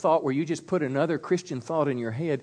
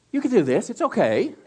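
higher, louder playful coaxing; higher, teasing reassurance to the husbands; 7 semitones higher, 4 dB louder than usual. Real, not acted. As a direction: tease them into covering their ears. Coaxing men to cover ears.

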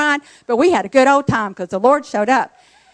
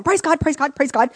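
rapid, higher-pitched burst of retold rejoicing; excited praise; 4 semitones higher than usual. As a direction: cheer inwardly as if jumping up and down. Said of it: "jumping up and down in my spirit".

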